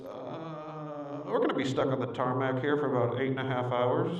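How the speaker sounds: low, understated mock pilot announcement; soft hesitant "uh", then low announcement; pilot on intercom. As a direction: lightly imitate a pilot breaking bad news. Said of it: "pilot comes back over the intercom".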